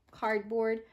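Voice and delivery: plain reading voice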